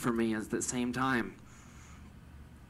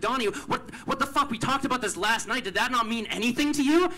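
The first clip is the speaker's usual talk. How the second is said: very high, loud, indignant outburst; yelling at a friend; 12 semitones higher, 5 dB louder than usual. Retold or imitated. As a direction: confront him at the top of your voice. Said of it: "then I yelled at him again".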